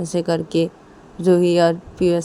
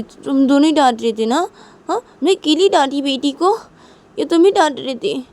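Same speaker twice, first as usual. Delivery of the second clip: raised, high-pitched defensive voice; indignant protest; 8 semitones higher, 4 dB louder than usual. Acted. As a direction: argue that you weren't the only one. Mother argues back over blame. (तुनककर बहस करते हुए)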